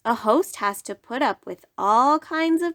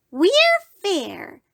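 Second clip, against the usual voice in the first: mild tiny bug voice; 10 semitones higher, 4 dB louder than usual. Acted. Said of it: "said the bug".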